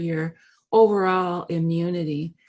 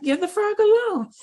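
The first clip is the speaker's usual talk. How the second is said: bank manager; 12 semitones higher than usual. Retold or imitated. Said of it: "and he says".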